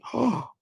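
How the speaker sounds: sharp intake of breath; shocked gasp. Real, not acted. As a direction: gasp at bad news. Reacts to bad popcorn bucket.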